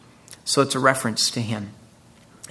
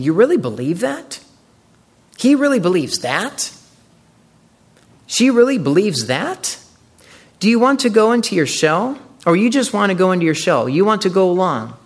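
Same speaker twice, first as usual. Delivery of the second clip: higher and louder, snarky disbelief; sneering taunts; 5 semitones higher, 5 dB louder than usual. Retold or imitated. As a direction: needle them until they back down. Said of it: "Everybody is sneering. Everybody is snarky".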